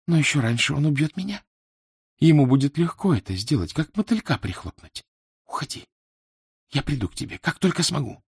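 plead, growing hushed towards the end; urgent, lightly voiced woman's plea. A narrator's reading. Woman pleads with him to leave.

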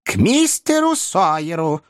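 put-on high, slow old-lady voice. A narrator's reading. Old lady directing her coachman to Mister Sawyer's, high old-lady voice. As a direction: give the order slowly, pitched well up. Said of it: "сказала старая леди".

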